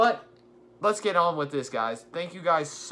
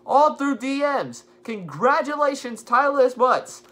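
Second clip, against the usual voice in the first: hyped giveaway announcement; 5 semitones higher, 6 dB louder than usual. Real, not acted. Congratulating the giveaway winner.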